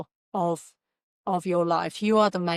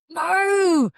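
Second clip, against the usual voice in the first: screaming warning; 11 semitones higher, 7 dB louder than usual. Pretend. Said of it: "what would they be screaming out at you".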